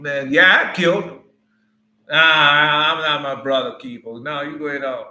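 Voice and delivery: loud, careless brush-off to God; arrogant Cain. Retold or imitated. Cain answering God, loud and arrogant. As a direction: toss it off like you fear nothing. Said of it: "That's how he was talking to God".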